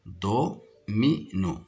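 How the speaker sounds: "Domino" slow, cut into do, mi, no; syllable by syllable. Shown as a demonstration. Teacher counting syllables in "domino" for pupils, syllable by syllable. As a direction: separate each syllable of "Domino". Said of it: "se compose de trois syllabes".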